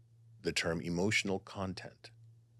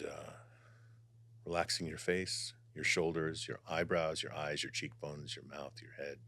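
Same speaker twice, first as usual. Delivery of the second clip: guided meditation; 5 dB quieter than usual. Acted. Guiding viewers to relax before channeling, soft meditation voice. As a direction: ease the listeners into calm.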